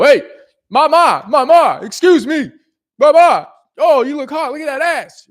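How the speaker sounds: loud, high shouted New York catcaller; aggressive catcalling. Shown as a demonstration. Coach imitating New York catcallers hollering at girls, aggressive shout. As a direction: holler it across the street, pushy. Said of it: "these guys are really aggressive with, with their, with their delivery".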